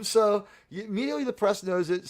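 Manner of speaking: usual speaking voice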